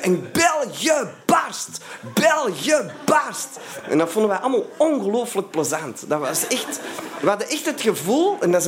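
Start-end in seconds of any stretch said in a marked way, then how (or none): 0.0-3.5 shouted slogan. Retold or imitated. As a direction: yell it out like kids at a rally. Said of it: "riepen we allemaal van".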